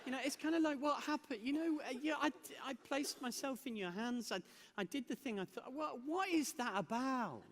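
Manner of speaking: high-pitched, exasperated plea; bewildered protest. Acted. Acted complaint to God.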